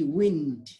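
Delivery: विंड said slowly with a short i as in it; विंड, short i vowel; short i wind. Shown as a demonstration. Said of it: "इन, ऐसा pronunciation होता है".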